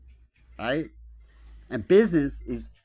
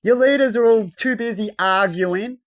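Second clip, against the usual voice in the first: usual speaking voice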